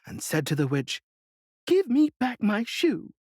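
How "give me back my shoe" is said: angry demand. A narrator's reading. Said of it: "grew angry".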